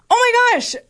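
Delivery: very high-pitched mock gasp of realisation; acted surprise. Studio owner realises the mix-up, high surprised exclamation. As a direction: imitate a startled, high-voiced studio owner.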